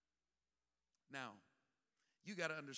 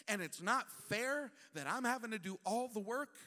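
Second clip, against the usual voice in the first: higher, louder, fed-up venting; exasperated complaint; 5 semitones higher, 6 dB louder than usual. Acted. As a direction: vent at him with attitude, two snaps and a twist. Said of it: "approaching Jesus like that with two snaps and a twist".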